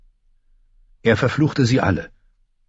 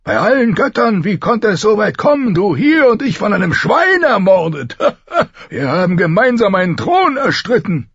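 high, loud king in full outburst; booming high-pitched lament with laughter; Robert's voice; 13 semitones higher, 4 dB louder than usual. A narrator's reading. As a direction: exclaim in disbelief, pitched far up, with a laugh. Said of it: "sagte Robert".

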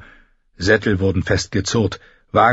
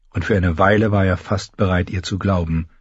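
ordinary delivery

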